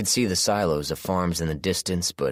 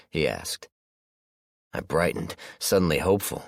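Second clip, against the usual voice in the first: ordinary delivery, nothing marked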